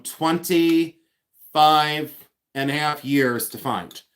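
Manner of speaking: very slow on "twenty-five", stretching each word; drawn-out emphasis. Shown as a demonstration. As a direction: drag out the number to make it sink in. Stressing how long it took.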